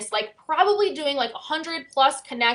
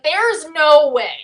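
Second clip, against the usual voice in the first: loud and slow, flat refusal; drawn-out loud disbelief; emphatic denial; 3 semitones higher, 8 dB louder, much slower than usual. Retold or imitated. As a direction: insist it can't be true. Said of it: "And I'm like".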